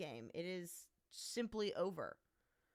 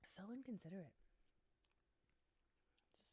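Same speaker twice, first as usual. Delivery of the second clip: whispering; 2 semitones lower, 9 dB quieter, much faster than usual. Real, not acted. Mock complaint.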